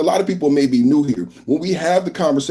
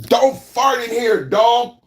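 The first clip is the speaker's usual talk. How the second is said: scolding the dog; 8 semitones higher, slower than usual. Real, not acted.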